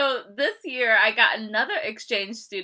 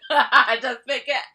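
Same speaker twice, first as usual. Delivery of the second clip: normal talking voice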